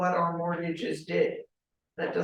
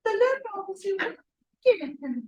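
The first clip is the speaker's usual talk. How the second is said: high, fast whiny complaint; mock a helpless new agent; squeaky mock-whine of an agent; 13 semitones higher, faster than usual. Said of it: "I've heard some crazy".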